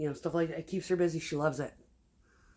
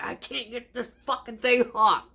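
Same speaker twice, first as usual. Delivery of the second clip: raised, higher mock outburst; imitated angry ex; 7 semitones higher, 4 dB louder than usual. Ex struggling with a greasy pan, imitated angry outburst. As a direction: complain loudly, acting out his frustration.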